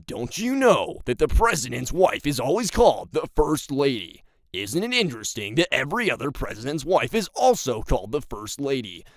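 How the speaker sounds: loud, swooping conspiracy theorist; stupid conspiracy voice. Acted. Conspiracy theorist proving women are not real, silly conspiracy voice. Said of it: "that stupid-ass, like, conspiracy voice".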